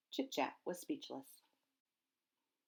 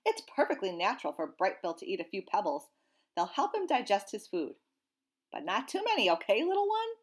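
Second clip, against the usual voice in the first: lightly voiced old goose, louder; a kindly old goose giving advice; 3 semitones higher, 6 dB louder than usual. Old goose reassures Roz and the gosling, lightly voiced.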